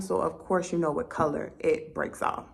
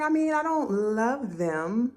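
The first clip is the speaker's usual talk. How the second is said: high, slow, hedging friend; imitating a politely reluctant friend; tactful, nice; 6 semitones higher than usual. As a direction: let them down gently in a high voice. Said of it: "try to say it in a nice way".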